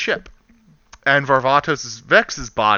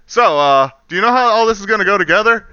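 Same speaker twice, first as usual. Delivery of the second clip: hammed-up teasing question; 8 semitones higher, 6 dB louder, faster than usual. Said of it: "he asks the guy".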